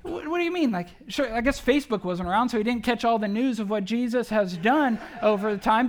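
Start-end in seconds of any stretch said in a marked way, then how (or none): 0.0-0.7 incredulous. Retold or imitated. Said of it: "almost like he's surprised, maybe incredulous even".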